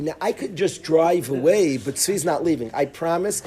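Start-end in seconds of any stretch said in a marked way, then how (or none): none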